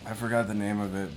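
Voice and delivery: ordinary talk, nothing marked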